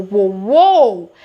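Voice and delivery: whoa shouted out loud; loud spooked whoa. Shown as a demonstration. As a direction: bark out whoa like something just felt wrong. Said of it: "It's giving weird vibes".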